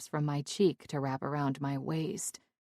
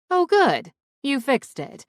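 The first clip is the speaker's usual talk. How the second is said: much higher, louder bright quip; cheery intruding friend; 9 semitones higher, 7 dB louder than usual. Acted. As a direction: chirp it in as the nosy friend. Jeannie interrupts, new character.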